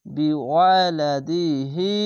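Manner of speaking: slow "বি ওয়া লা দি হি" with the long i held; drawn-out vowel. Shown as a demonstration. Teacher showing khara-zer lengthening, vowel drawn out. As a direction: stretch "হি" for one alif. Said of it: "খারজেরে এক আলিফ টেনে পড়বেন".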